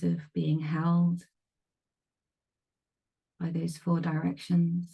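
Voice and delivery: calm meditation guide with long pauses; spacious guided-meditation voice, leaving silence between phrases. Real, not acted. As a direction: ease them into stillness, phrase by phrase. Leads a meditation.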